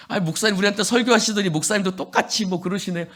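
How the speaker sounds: fast, teasing jab at the pastor; mocking congregant. Congregant needling the pastor, quick and mocking. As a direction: needle him quickly with a sneer. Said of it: "목사님한테 가서 이런 거예요".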